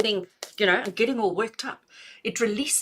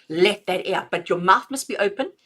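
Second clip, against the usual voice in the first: normal talking voice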